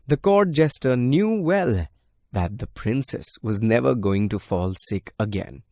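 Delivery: plain reading voice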